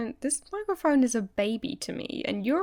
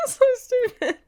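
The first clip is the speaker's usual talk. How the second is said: laughing; 14 semitones higher, slower than usual. Real, not acted. Giggling after joke.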